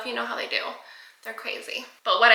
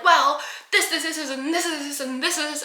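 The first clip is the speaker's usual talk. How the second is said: imitating a rambling upsell, slightly higher; mock sales spiel; 4 semitones higher than usual. Pest control rep pitching upsells, mock sales spiel.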